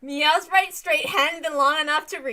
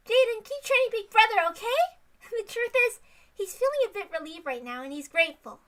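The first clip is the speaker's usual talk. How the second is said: put-on girl voice; 7 semitones higher, faster than usual. Reads the girl character.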